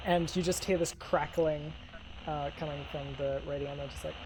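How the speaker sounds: ordinary talk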